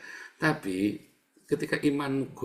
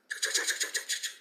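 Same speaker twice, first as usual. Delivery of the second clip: unvoiced rattle of "cek, cek"; imitated shaking noise; 6 dB quieter than usual. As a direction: imitate stones shaken in a container. Menirukan bunyi wadah digoncang. (tiruan bunyi goncangan)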